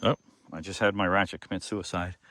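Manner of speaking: ordinary delivery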